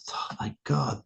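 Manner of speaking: breathy whispered oh my God; exasperated whisper. Real, not acted. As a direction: whisper it in disbelief. Unvoiced exasperation over Beto.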